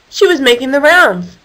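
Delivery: usual speaking voice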